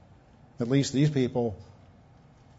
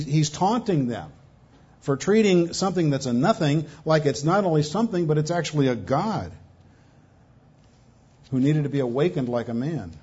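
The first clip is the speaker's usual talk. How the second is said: usual speaking voice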